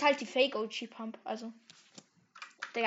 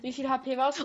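ordinary talk, nothing marked

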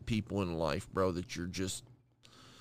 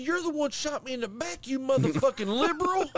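mock Southern country rant, much higher; country accent; 14 semitones higher, 5 dB louder than usual. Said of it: "he was country as fuck".

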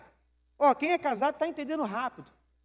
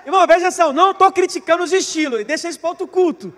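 much louder, higher disclaimer; loud protest; 4 semitones higher, 9 dB louder than usual. Real, not acted. Pastor to congregation: loud laughing disclaimer.

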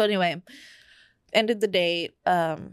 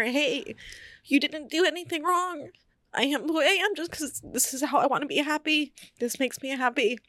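comforting; 8 semitones higher than usual. Retold or imitated. Comforting her crying mother.

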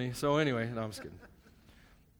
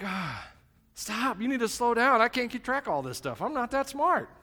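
high-pitched, mock-flustered protest; overwhelmed plea; 8 semitones higher, 4 dB louder than usual. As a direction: plead for a pause, pitched way up. Acts overwhelmed plea to God.

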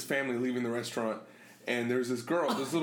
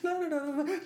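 high, giggly retold protest; half-laughed mock plea; laughing imitation; 12 semitones higher than usual. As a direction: mimic a squealed protest while laughing. Imitates girl grabbed by dad.

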